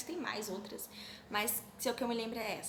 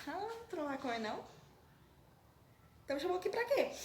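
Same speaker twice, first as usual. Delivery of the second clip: a hint of a sulky brother, rising higher; mock complaint; 7 semitones higher, faster than usual. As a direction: whine it as if offended. Aminon reclama com Tamar. (leve queixa de irmão emburrado)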